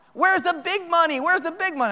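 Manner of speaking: high, insistent retold robber's demand; mock scream. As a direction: imitate screaming without the full volume. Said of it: "he starts screaming".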